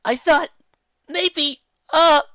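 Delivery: high loud stammer; flustered Twilight. Acted. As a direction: fumble for words.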